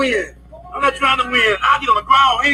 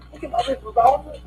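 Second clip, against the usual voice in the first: usual speaking voice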